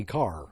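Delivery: "car" with a full R at the end; pronounced R. Shown as a demonstration. Host showing what he doesn't say, "car" with a clear R. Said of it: "I don't say".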